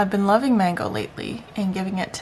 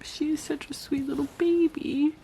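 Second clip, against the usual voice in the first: tearful; 6 semitones higher, 4 dB quieter than usual. Real, not acted. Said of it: "I'm gonna cry".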